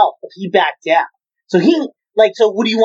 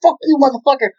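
higher-pitched, friendly-sounding curse; mock nice cursing; 6 semitones higher, faster than usual. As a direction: toss the insult out as if being nice. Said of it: "They're all nice to him".

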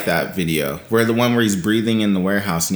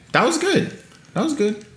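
high-pitched. Real, not acted. Approving a song pick.

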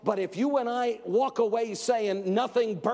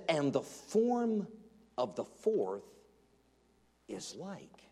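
slow suspense; 3 semitones lower, 4 dB quieter, slower than usual. Acted. Dramatic slow quote trailing off.

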